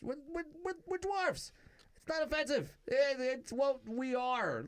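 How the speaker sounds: very high, incredulous insistence; exasperated protest. Acted. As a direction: stammer into a high-pitched protest. High exasperated protest about the term.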